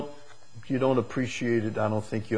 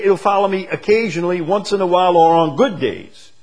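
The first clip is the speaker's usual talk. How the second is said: mock dismissive quote; 8 semitones higher, 8 dB louder than usual. Shown as a demonstration. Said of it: "He doesn't say".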